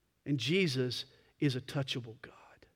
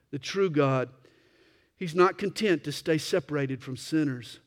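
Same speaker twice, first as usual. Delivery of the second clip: ordinary delivery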